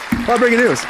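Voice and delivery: playful mock newscaster hype; announcer voice. Shown as a demonstration. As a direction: sell it like a news teaser. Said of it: "No, a lot of breaking news".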